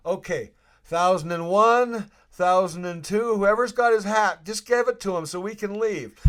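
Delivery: hammed-up countdown to the team; joking countdown to teammates on the plane; mock countdown. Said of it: "I'm like".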